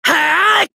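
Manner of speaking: high-pitched fighting yell; battle shout. Acted. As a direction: let out a high attack cry. Battle cry in game voice lines.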